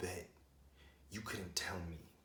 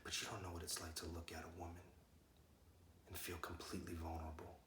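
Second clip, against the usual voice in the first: soft, intimate confession; hushed sincerity; 8 dB quieter than usual. Acted. Man telling a kid about loving a woman, hushed and sincere. Confides what love is like.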